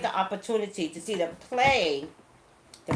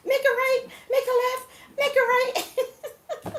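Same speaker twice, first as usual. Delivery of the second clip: high, loud retold yelling; hammed-up shout, breaking into laughter; frantic shouted directions; 6 dB louder, slower than usual. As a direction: act out urgent directions on the run. Acts out shouting directions to family.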